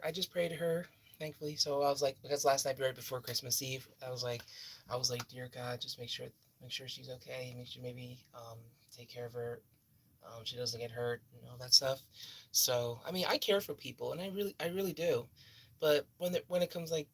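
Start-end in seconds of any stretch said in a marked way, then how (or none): 5.3-12.0 soft, low prayer voice; whispered prayer to God; hushed prayer. Retold or imitated. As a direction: pray quietly for her safety. Quoting her prayer for the woman.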